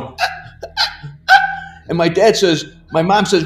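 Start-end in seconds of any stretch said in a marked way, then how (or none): 0.0-1.3 imitated breathless gasps jumping to a squeak; pained gasps. Child with hand stuck in car door, mimicked gasps of pain. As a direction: act out choked little cries of pain. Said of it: "It hurt so bad. And I'm going".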